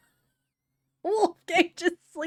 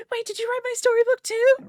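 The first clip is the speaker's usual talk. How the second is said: high, loud disbelief; incredulous character; 8 dB louder than usual. Acted. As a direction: gasp it out in shock.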